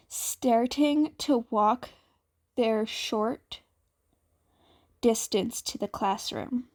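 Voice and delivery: ordinary delivery, nothing marked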